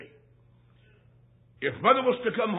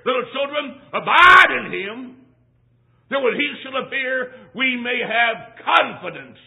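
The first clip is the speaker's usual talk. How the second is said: preaching at full force; 5 semitones higher, 8 dB louder than usual. Acted. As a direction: proclaim it to the congregation. Preacher quoting scripture.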